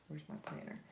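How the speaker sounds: unvoiced mutter to herself; muttered aside. Real, not acted. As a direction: mumble it while searching the desk. Muttering while searching.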